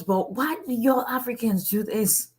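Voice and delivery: a hint of a baffled official asking; mock passport lady. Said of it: "And she goes".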